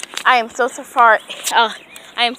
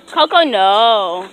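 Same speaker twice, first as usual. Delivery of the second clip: loud, slow no to the dog; loud slow warning; firm warning; 9 dB louder, much slower than usual. Real, not acted. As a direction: stop the dog from the street. Dog heading to street.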